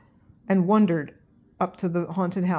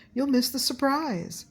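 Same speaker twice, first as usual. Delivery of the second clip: pumpkin voice; 7 semitones higher than usual. A narrator's reading. Pumpkin teases.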